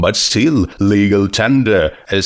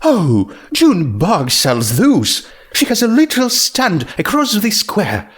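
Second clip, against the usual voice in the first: higher, ladylike and unhurried; a refined unicorn explaining kindly; lightly voiced posh mare, higher; Rarity voice; 9 semitones higher than usual. A narrator's reading. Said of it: "Rarity voiced in the story".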